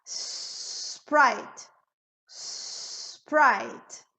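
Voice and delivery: "Sprite" with a very long hissed S before PRITE; blown initial S. Shown as a demonstration. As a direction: draw out the S of "Sprite", no vowel before it. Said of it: "acostuma a soprar". ("Sprite" com S longo soprado)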